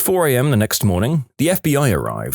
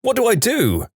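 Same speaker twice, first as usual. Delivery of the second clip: high-pitched panicked plea for help; put-on high, frantic voice; panicked caller; 13 semitones higher than usual. Hunter phones the operator for help, panicked and high.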